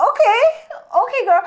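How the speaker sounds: high-pitched, lightly acted approval; impressed reaction. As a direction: play a mildly hammed-up impressed girlfriend. Acts her reaction to dancers.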